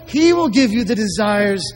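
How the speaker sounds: "He" hit firmly as the answer; stress on HE. Shown as a demonstration. Preacher answering who gives you joy, with "He" stressed. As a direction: punch "He", then carry on through the phrase. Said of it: "Who gives you joy?".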